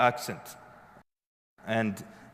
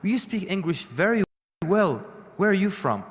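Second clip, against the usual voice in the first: lightly acted, higher-pitched questioner; a higher, slightly put-on questioning voice; 6 semitones higher than usual.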